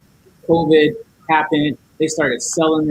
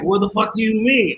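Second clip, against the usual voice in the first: higher-pitched mock indignation; acted-out cussing, raised in pitch but not volume; retold angry outburst; 6 semitones higher than usual. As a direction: imitate a customer going hard at the clerk. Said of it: "He's, like, going hard".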